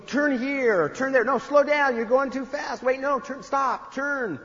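micromanaging father from the passenger seat; backseat-driving dad. Retold or imitated. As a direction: nag her through every turn. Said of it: "he kept saying".

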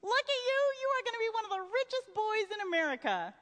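sing-song high parody of a rich stepfather; mocking Mister Moffat. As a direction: lampoon the smug stepfather's boast. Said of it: "it ends with Mister Moffat saying".

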